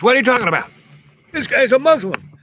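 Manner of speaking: high, loud, defiant retort in a put-on voice; indignant outburst. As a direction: bark it back at the narrator. Angry man snaps back at narrator.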